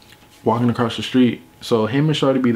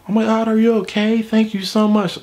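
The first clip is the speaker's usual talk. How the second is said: high-pitched imitation of gushing girls; grateful girls; 10 semitones higher than usual. Girls thanking him across the street, gushing. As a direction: mimic relieved girls in a raised voice. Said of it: "the girls are like".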